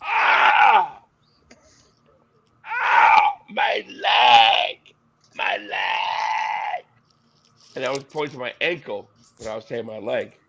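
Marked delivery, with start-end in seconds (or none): 0.0-6.9 hammed-up high cries ending in a strained "My leg!"; mock pain cries. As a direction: fake a leg injury, loud and high. Retelling fake injury at tryout.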